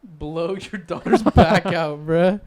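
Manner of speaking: hammed-up crude boyfriend brag; mock crude boast. Jokes crude answer to dad.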